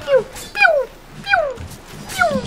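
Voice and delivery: high-pitched "pew" gun noises; imitated laser pews. Player mimicking a toy laser gun, high pews. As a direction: fire off little falsetto laser shots. I much more prefer the pew pew.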